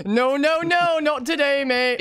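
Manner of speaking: high, laughing taunt; gleeful refusal. Real, not acted. Player telling killer not today, gleeful taunt.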